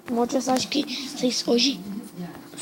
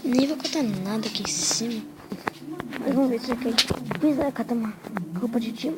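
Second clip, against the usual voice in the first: normal talking voice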